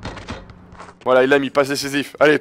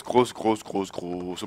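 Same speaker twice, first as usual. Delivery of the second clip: each grosses lower and softer; fading repetition; 9 semitones lower, 11 dB quieter, much slower than usual. Real, not acted. Gamer trailing off while promising a beating, fading. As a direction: let grosses fade to nothing.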